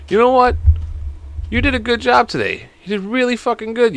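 high-pitched, patronizing praise; mock compliment. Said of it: "giving them a bunch of compliments".

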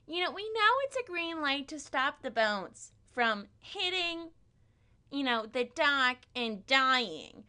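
very high, hammed-up teacher voice; English teacher impression. Teacher explains Gatsby's green light, high mock-teacher voice. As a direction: lecture with exaggerated literary fervor. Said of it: "This is indeed my impression of my, um, eleventh grade English teacher".

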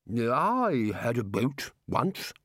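slow, dreamy, befuddled voice; addled bursar. Acted. Muddled bursar answering the Dean, slow and vague. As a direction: drag the words out as if far away.

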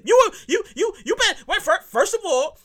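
high-pitched, sputtering excitement; excited stammering rant; worked up. Real, not acted. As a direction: stumble over words, too worked up to finish. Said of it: "I get a little excited".

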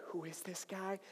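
mock whisper to a friend; very soft, confidential question; hushed aside. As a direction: ask it secretly under your breath. Girlfriend asks her friend aside.

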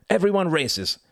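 high, brisk exclamation; emphatic cry. A narrator's reading. Badger overruling nervous Mouse, emphatic high cry. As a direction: call out the rule, high-pitched. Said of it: "cried Mister Badger".

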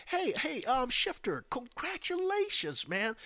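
high-pitched fake congratulations; pretend happy. Outgoing president congratulating the man who won, high-pitched fake cheer. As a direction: overdo the friendliness, very high. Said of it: "pretend he's happy for you".

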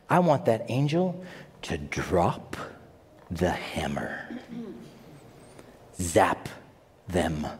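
low, soft, menacing wish for the hammer; a hint of gloating old self; mock vengeful mutter. Preacher imitating his younger angry self, low and menacing. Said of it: "how I would respond".

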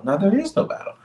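rising, insistent preacher's cry; emphatic high protest. Real, not acted. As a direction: insist there is no battle, voice climbing high.

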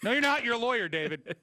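high, loud teasing outburst; mock outraged retort. Host teasing absent David, a loud high mock protest. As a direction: call David out, half-joking.

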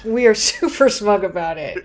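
smug and laughing, super punched. Real, not acted. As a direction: chuckle through it, gloating. Gloating about critics, laughing.

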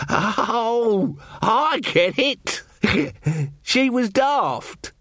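very high, drawn-out dim villager; dawning realisation. Acted. Slow villager finally getting the joke, high amused realisation. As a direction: squeal it slowly as the joke lands.

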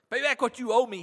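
high, loud furious demand; angry demand. A narrator's reading. Servant choking his fellow servant, shouting in anger. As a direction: bark the debt at him. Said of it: "he demanded".

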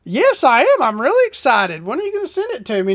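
high, sing-song fake enthusiasm; mock excitement. Caller feigning joy to scammer, mock excited. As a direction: play along with fake glee.

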